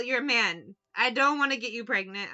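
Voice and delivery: ordinary talk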